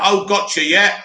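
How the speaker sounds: ordinary talk, nothing marked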